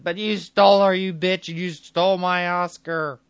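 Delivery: put-on very loud, high drunken bellow; drunken rage. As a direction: roar it like a furious drunk. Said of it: "in drunken fashion".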